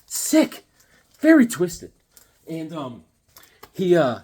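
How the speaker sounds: usual speaking voice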